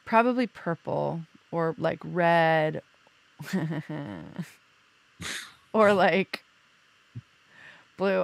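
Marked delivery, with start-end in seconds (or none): 3.4-4.4 soft, low-key chuckle; amused chuckle. Real, not acted. Teasing partner who hates red, amused chuckle. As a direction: chuckle quietly to yourself. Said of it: "I'm laughing 'cause you hate red".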